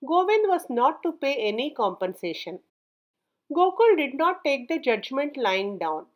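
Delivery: ordinary delivery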